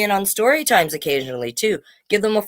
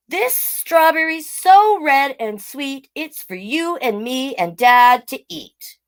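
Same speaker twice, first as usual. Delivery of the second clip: put-on higher, slow little child's voice; little turtle voice; 6 semitones higher, slower than usual. Little turtle giving his mama a strawberry, high and slow. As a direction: sweetly offer it up like a small child. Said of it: "he said".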